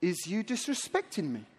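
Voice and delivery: quietly offended sketch-comedy pig; Catherine Tate. Acted. Pig answers the insulting lost son, soft low mock offence. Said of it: "how Catherine Tate would act out".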